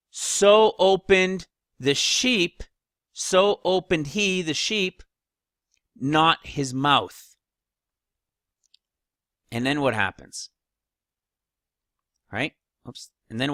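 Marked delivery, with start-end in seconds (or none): 0.1-7.4 slow, raised, spaced-out phrases; deliberate scripture reading. Acted. Preacher reading out the sheep's silence, slow and emphatic. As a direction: lay the verse out piece by piece.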